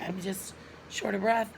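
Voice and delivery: normal talking voice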